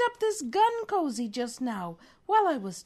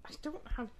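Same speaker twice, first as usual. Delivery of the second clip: normal talking voice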